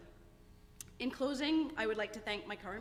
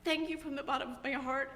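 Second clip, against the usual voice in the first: higher, louder, voice full of tears; choked up with tears; 6 semitones higher, 8 dB louder than usual. Real, not acted. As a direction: push the thanks out through real tears. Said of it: "I don't know why I'm so emotional".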